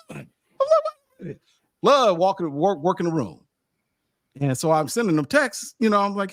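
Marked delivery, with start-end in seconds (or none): 0.1-3.4 amazed. Retold or imitated. Amazed at Flood's entrance.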